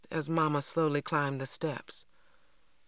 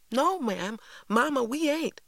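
put-on high-pitched child's protest; 11 semitones higher, 3 dB louder than usual. A narrator's reading. Cassie objects when Mama sends the children to bed, protesting. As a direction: plead the case in a high, louder voice.

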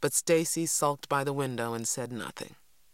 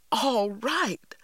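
outraged outcry, pitched well higher and louder; indignant cry; 8 semitones higher, 4 dB louder than usual. A narrator's reading. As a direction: throw his words back at him. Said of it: "I cried, indignant".

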